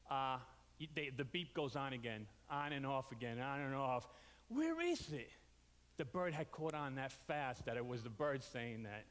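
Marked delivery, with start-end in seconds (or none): 4.5-5.3 puzzled search. Retold or imitated. Voicing confused searcher at night.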